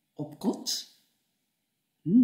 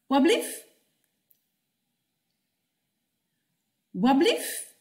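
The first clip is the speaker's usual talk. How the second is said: high rising "Wat blief?"; bright questioning; 6 semitones higher than usual. Shown as a demonstration. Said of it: "Altijd goed". (hoog en vragend)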